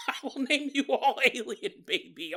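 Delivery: high-pitched put-on alien voice; mock proclaiming alien father. Alien father to ten thousand babies, mock proclamation. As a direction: proclaim it over the brood, jokingly.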